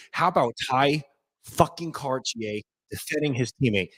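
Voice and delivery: normal talking voice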